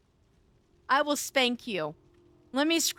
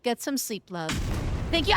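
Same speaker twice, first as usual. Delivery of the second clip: ordinary delivery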